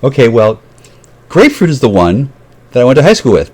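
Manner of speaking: usual speaking voice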